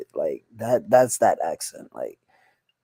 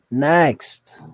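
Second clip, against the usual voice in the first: NEXT called out loud and higher; emphatic call; 4 semitones higher, 9 dB louder than usual. Real, not acted. Reactor moving on from the clip, emphatic call on NEXT. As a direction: punch out NEXT as a loud dismissal. Repeats the word much more forcefully.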